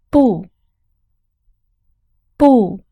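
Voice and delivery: falling fourth tone on 不. Shown as a demonstration. Teacher models 不 with a falling tone. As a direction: drop sharply on 不. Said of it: "不 has two sounds".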